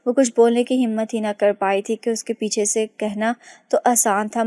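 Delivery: usual speaking voice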